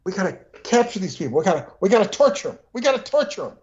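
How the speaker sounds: fast, high mock Cajun accent; James Carville Cajun accent. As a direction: rattle it off like a riled-up Cajun pundit. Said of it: "you know, he says".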